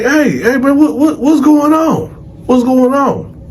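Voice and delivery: high-pitched, agitated questioning; urgent calling out. Retold or imitated. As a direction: call out anxiously for answers, stumbling over words. Inmates calling out to find out what's happening.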